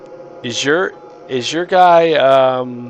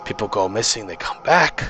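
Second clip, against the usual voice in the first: dwarf, uneasy and secretive; 4 dB quieter than usual. Acted. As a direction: confide something eerie, with pauses.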